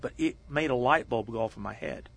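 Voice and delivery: usual speaking voice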